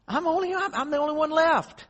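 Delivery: high, strained cry of a man alone; despairing prophet. Retold or imitated. Elijah crying out to God, high and desperate. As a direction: wail that you're the last one left.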